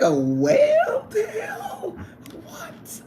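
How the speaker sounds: high, slow incredulous squeal; mock disbelief. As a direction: squeal it in playful shock. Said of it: "I was like".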